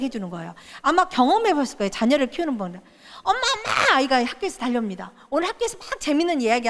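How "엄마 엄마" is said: put-on much higher, excited child's call; child calling mom. A child running from school calling for mom. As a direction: call out like a kid running home.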